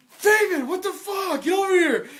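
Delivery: mock shout across the room; excited call. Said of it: "And he's like".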